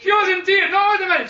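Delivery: pushing back against the audience. Real, not acted. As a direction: cut through the room's laughter. Protests over the laughter.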